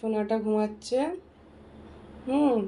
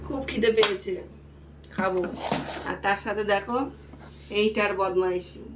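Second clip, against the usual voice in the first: a hint of a lower pet voice speaking for itself; voicing the pet; 5 semitones lower, 3 dB quieter than usual. Owner speaking for her hungry pet, lightly lower pet voice. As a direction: lightly put on, the cat's own complaint. Voicing the pet's hunger, low.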